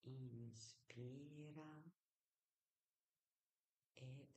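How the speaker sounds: soft, slow meditation whisper; guided meditation. Shown as a demonstration. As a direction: hush them into calm, slowly. Iniziamo insieme con una brevissima meditazione guidata.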